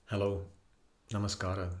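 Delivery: usual speaking voice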